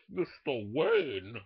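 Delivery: slow, menacing Bane drawl. Retold or imitated. A comic doing Bane to the crowd, slow and menacing. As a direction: lean on each word like the masked villain. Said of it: "Oh, (Bane impression follows)".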